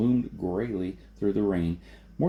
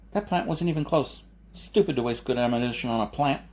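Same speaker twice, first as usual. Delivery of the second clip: put-on higher, scornful young voice; a boy scolding the wasteful shot; boy's scolding voice; 4 semitones higher than usual. A narrator's reading. Griff the boy scolding Jason, higher scornful voice. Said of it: "Griff said".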